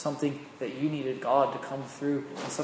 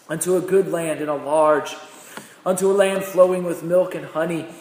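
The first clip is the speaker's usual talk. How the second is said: fervent preached reading; preaching at full force; 3 semitones higher, 7 dB louder than usual. Acted. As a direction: proclaim God's promise boldly.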